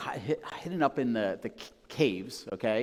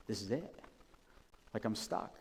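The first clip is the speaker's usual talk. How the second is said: much softer, hopeless inner voice; resigned despair; 4 semitones lower, 12 dB quieter than usual. Retold or imitated. Abigail trapped in her marriage, quiet hopeless resignation. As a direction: sink into quiet defeat.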